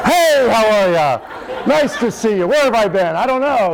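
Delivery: loud, high-pitched comic greeting; revived, chipper father. Retold or imitated. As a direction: play a dad back to life, greeting everyone, big and high. Plays the transformed father after treatment.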